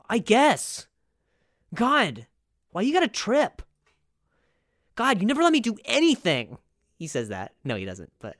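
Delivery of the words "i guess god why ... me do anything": high, petulant mock whine; whiny complaint. Imagined boyfriend complaining to his girlfriend, whiny. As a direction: sulk like a scolded teenager.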